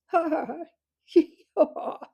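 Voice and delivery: loud, high hooting cackle; screeching owl laughter. A narrator's reading. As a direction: hoot with helpless glee. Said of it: "screeching laughter of the owl".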